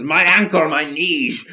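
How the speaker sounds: high, loud mock drunk complaint; hammed-up drunkard whining about his legs; a drunk man crying out over his sore legs; drunkard muttering. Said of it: "the drunkard who mutters".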